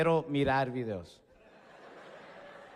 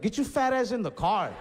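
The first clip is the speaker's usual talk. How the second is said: annoyed; 4 semitones higher, 5 dB louder than usual. Retold or imitated. Snapping at cousin.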